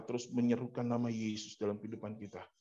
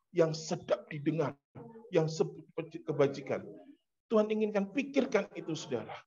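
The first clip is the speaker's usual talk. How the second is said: high, raised preaching voice; forceful preaching; 6 semitones higher, 5 dB louder than usual. Real, not acted. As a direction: drive each phrase home to the congregation. Preacher drives the point home. (khotbah lantang dengan nada tinggi)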